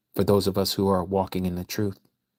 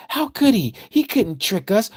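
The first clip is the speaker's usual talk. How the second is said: much higher, mocking believer voice; 13 semitones higher than usual. Preacher mocking Christians who say they felt the Spirit, much higher. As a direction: parody a believer insisting they can't be tricked. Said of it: "because you're saying".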